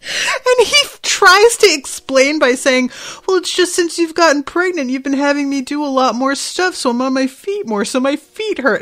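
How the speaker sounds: high voice, recovering from laughter; laughing retelling. Real, not acted. Retelling husband's clueless excuse to pregnant wife, high and amused. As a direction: rush it out high, still tickled.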